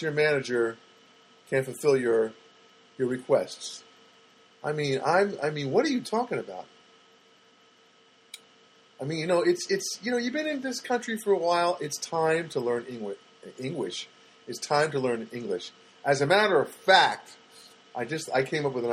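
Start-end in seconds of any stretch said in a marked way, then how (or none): none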